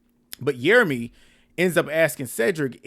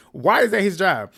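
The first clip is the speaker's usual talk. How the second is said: louder and higher, laughing in disbelief; exasperated laughing repeat; 6 semitones higher, 8 dB louder than usual. Real, not acted. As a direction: throw the question out again, laughing and incredulous. Rhetorical question repeated for emphasis.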